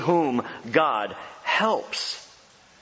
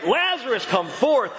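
raised commanding call; loud summons; 6 semitones higher than usual. Acted. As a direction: call out so he hears. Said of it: "Someone's gotta be loud about this".

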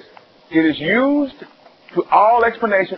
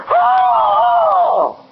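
hammed-up high startled "Oh!"; a fake shocked yelp, much higher; mock gasp; 12 semitones higher, 4 dB louder than usual. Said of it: "throw my gun down and say".

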